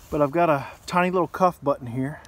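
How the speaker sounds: ordinary delivery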